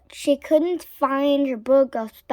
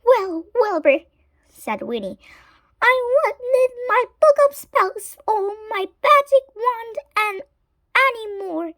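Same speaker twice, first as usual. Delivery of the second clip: high, fast, louder witch voice; high smug witch; 10 semitones higher, 3 dB louder, faster than usual. A narrator's reading. Winnie telling Wilbur she won't need spells, smug. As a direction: tell the cat off brightly.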